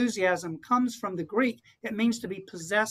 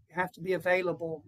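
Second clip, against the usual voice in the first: ordinary talk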